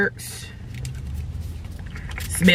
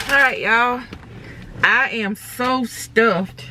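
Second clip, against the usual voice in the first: talking through a face mask; muffled behind a mask; 4 semitones higher, slower than usual. Real, not acted. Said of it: "Let me take my mask off where y'all can hear me".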